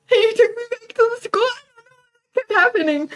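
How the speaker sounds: high-pitched talk through laughter; laughing. Real, not acted. Storyteller baffled at being driven back to school, laughing. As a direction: laugh in bafflement while retelling. Laughing at how the date ended.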